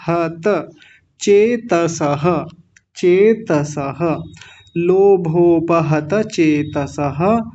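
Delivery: लोभोपहत चेतसः slowly, syllable by syllable; slow Sanskrit drill for learners. Shown as a demonstration. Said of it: "प का विषय ध्यान देंगे".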